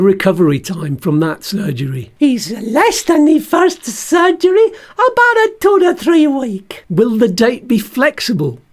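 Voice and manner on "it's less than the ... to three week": cheeky puppet surgeon with a much higher pitch; Bert the stoma bag as the surgeon. Pretend. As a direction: squeak it out like a little puppet doctor. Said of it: "can you be the surgeon for me".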